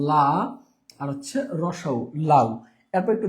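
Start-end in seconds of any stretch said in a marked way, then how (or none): 0.1-0.3 লা held long as a separate syllable; split-off syllable. Shown as a demonstration. Teacher breaking a word apart for students, long isolated লা. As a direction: draw out the vowel of লা on its own. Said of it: "লাউ শব্দটাকে ভাঙলে হয়".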